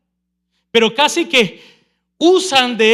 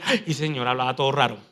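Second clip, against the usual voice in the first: mock frightened; 8 semitones lower, 6 dB quieter than usual. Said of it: "salió la persona ya asustada y dice".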